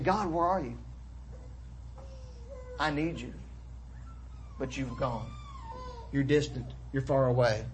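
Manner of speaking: hushed, halting plea with long pauses; abandoned cry. Retold or imitated. Preacher voices David abandoned by God, quietly forlorn. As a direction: let the psalmist's lonely prayer sink softly. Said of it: "hear the cries of David as he feels abandoned".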